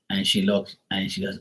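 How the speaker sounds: ordinary delivery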